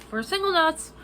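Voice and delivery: usual speaking voice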